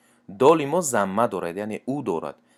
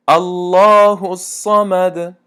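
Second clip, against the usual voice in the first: slow, drawn-out chanted recitation, higher and louder; Quran recitation; 8 semitones higher, 8 dB louder, much slower than usual. Shown as a demonstration. Teacher chanting verses for students, slow melodic recitation.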